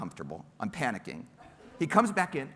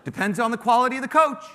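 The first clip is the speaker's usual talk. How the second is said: cocky retort; 7 semitones higher, 9 dB louder than usual. Retold or imitated. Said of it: "his retorted answer".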